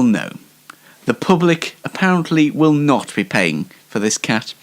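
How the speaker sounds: ordinary talk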